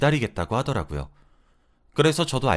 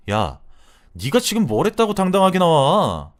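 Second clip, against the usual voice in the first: higher and louder furious demand; angry yell; 5 semitones higher, 6 dB louder than usual. Retold or imitated. Wife yells at her friend for acting proud, angry shout.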